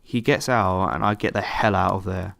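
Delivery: ordinary delivery, nothing marked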